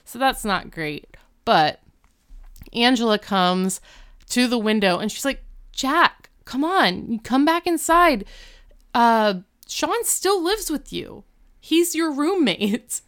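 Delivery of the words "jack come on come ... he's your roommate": much higher, hammed-up coaxing girl; pleading girlfriend. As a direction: coax him off the ledge in a high put-on voice. Angela coaxes Jack from ledge.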